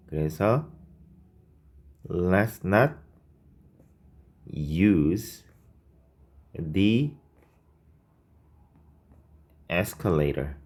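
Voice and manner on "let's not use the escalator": each word drawn out with pauses between; slow dictation of the correct version. Shown as a demonstration. Teacher slowly dictating the corrected sentence to students. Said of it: "이렇게 써주시면 됩니다".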